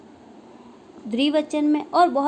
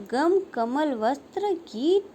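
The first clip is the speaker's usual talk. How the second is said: usual speaking voice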